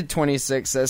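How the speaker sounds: usual speaking voice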